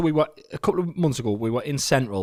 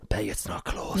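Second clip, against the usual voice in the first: low, hushed confidential aside; imitating Rosie; 5 semitones lower, 7 dB quieter, slower than usual. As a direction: lean in with a mock secretive murmur. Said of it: "Rosie leans in and goes".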